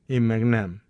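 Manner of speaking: plain reading voice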